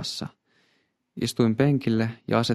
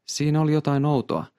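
ordinary delivery, nothing marked

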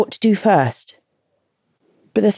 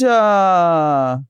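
whiny groan. Retold or imitated. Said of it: "It's a whingy, whiny voice".

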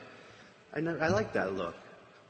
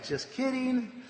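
teasing; 10 semitones higher, slower than usual. Retold or imitated. Samson teasing his ambushers.

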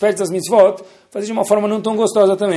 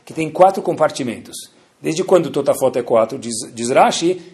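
usual speaking voice

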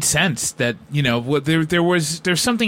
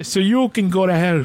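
high, mock-offended Scottish retort; horrible fake Scottish accent; 4 semitones higher than usual. Co-host telling off his critic, in a horrible Scottish accent. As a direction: snap back in badly faked Scottish. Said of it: "It was absolutely horrible".

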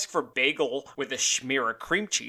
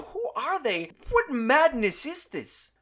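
slow, higher theatrical disbelief; slow, much higher dramatic lament; mock bafflement; 10 semitones higher, slower than usual. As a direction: ham up the outrage, drawn out. Mock outrage at restaurant pricing.

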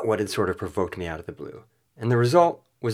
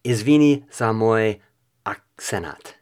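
slow Serbian attempt, pausing before "akcenat"; butchered Serbian; 6 dB louder, slower than usual. Shown as a demonstration. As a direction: sound out Serbian haltingly, louder on "Izvini". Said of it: "And now for Serbian".

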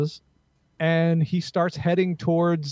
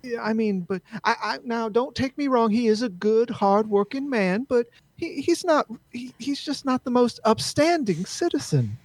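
higher-pitched Southern society lady; upper-crust Southern accent; 7 semitones higher than usual. Acted. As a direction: hedge politely in a genteel Southern voice. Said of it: "all the upper crust all have that Southern accent".

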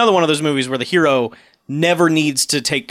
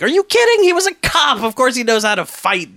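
going nuts; 8 semitones higher than usual. Retold or imitated. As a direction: blow up in disbelief. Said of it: "John Rhys Davies just goes nuts".

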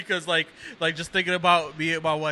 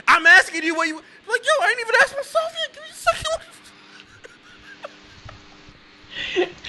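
much higher, fast and laughing; flustered husband answering his wife through laughter; flustered laughing protest; 5 dB louder than usual. Retold or imitated.

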